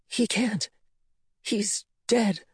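daughter's voice. A narrator's reading.

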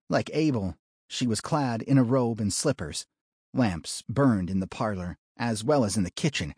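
ordinary delivery, nothing marked

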